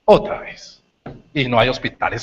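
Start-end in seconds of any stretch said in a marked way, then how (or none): 0.0-0.8 weary wife. Acted. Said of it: "La señora salió y dijo".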